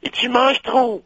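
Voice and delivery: very high falsetto French; mock a teacher in a shrill-pitched squeal; French teacher impression. Quoting the teacher scolding a pupil, high falsetto impression. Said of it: "She used to go".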